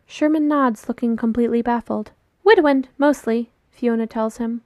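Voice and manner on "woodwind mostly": higher, louder chirpy answer; chirpy child. A narrator's reading. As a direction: pipe up like an eager child. Same chirpy child keeps talking.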